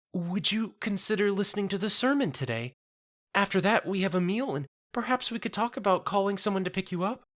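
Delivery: put-on a kindly young church sister soothing a stranger; gentle churchwoman. Marifold consoling Jenna in a high gentle voice. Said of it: "in a soft voice".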